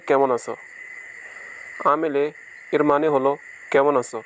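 ordinary talk

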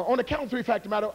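ordinary talk, nothing marked

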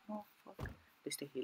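barely audible, frightened murmur; hushed panic. Real, not acted. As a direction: keep it very soft so nobody hears you. Hiding quietly from someone.

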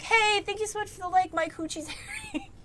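high-pitched mock thank-you; imagined grateful streamer. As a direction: put on a high voice, dissolving into giggles. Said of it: "someone will be like".

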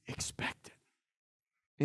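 hushed whisper, slowly. Real, not acted. Pastor whispering a promise to the congregation, slowly. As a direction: lean in and breathe it like a secret, slowly.

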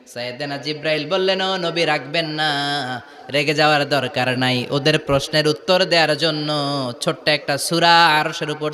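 intoned sermon. Acted. Preacher telling how Gabriel calms the Prophet, intoned sermon.

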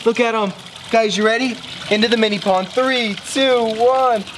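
high, eager cheering on; excited cheering. Real, not acted. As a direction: urge them on with glee. Cheering goldfish into pond.

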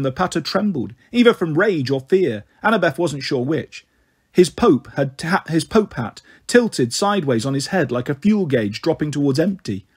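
ordinary delivery

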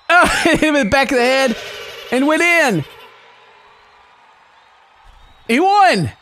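high, amused cheering; excited celebration. Real, not acted. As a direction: cheer the surprise win. Celebrating the winning hit.

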